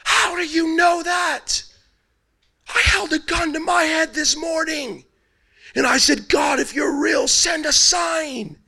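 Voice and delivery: high, drawn-out mock wail; imitated crying. A man on his knees asks how he knew, imitated crying. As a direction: wail it slowly like someone breaking down in tears. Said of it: "the guy starts crying".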